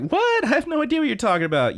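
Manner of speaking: high, rushed mock protest; flustered denial. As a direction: squeak out an indignant denial. Character's flustered denial, acted.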